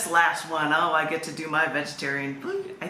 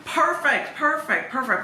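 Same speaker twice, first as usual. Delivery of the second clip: excited; 8 semitones higher, 3 dB louder, slower than usual. Real, not acted. Thrilled a whale poem follows.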